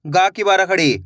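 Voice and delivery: normal talking voice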